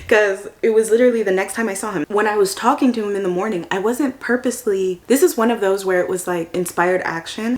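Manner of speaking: ordinary talk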